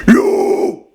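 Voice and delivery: loud airy growled yo; low false chord scream. Shown as a demonstration. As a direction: push a low guttural yo.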